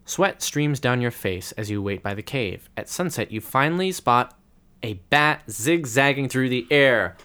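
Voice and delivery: building narration up to the sighting; story narration. A narrator's reading. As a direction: read it like a gamebook. Game master reads the adventure.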